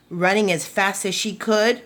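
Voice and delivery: plain reading voice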